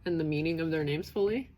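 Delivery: normal talking voice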